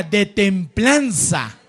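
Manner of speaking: very slow, each syllable laid out; drawn out for the people to echo; slow repeat-after-me. Shown as a demonstration. Preacher slowly spells out a word for the church to repeat.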